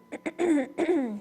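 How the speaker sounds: rough throat clear. Real, not acted. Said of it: "excuse me, sorry".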